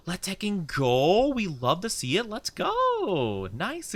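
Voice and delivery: high, drawn-out hype; excited cheer. Real, not acted. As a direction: celebrate for the chatter, stretching the words. Cheers chatter's game news.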